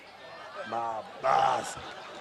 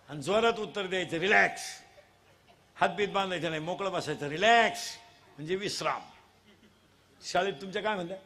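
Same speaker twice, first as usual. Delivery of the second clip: usual speaking voice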